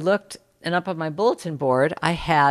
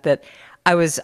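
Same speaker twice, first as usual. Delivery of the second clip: ordinary delivery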